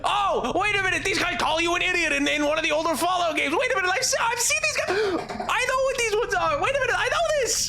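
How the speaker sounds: overjoyed high-pitched outburst; real excitement. Real, not acted. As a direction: yell like you just spotted your favorite thing. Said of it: "I got really excited".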